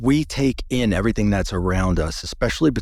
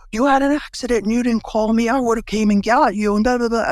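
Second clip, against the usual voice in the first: much higher, louder scolding wife voice; shrill-free but pitched way up, mock exasperation; upset wife; 13 semitones higher, 3 dB louder than usual. Wife scolding him for not calling after the crash, higher and louder.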